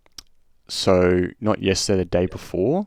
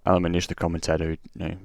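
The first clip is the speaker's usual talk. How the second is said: ordinary talk, nothing marked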